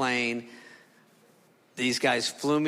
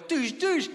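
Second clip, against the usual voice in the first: high squeals of fear; 11 semitones higher than usual. Retold or imitated. Turbulence hits the plane.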